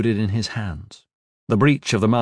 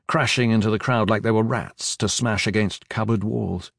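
ordinary delivery

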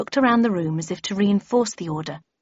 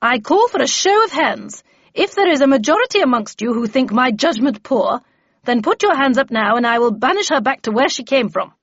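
louder, higher imperious challenge; fierce temper; 6 semitones higher, 7 dB louder than usual. A narrator's reading. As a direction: dare the room to disagree. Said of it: "with such a show of fierce temper".

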